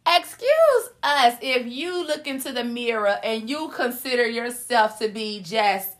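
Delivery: ordinary talk, nothing marked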